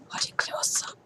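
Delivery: whispered aside, no voice. Real, not acted. Vlogger whispering to the camera about being watched.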